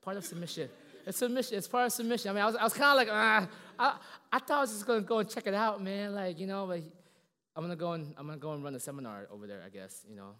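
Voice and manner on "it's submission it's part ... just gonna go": emphatic loud. Real, not acted. Sermon climax, loud.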